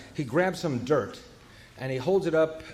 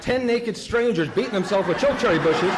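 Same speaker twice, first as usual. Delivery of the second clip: rising higher and louder, faster at the end; comic outrage climbing to a squeal; incredulous disbelief; 7 semitones higher, 5 dB louder than usual. Retold or imitated. Incredulous inner thought.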